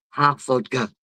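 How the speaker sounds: ordinary delivery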